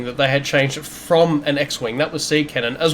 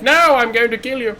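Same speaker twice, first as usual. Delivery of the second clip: high-pitched mock villain threat; silly, raised-pitch defiant outburst; Valance; 8 semitones higher than usual. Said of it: "I'm sure that's exactly how Valant sounds too".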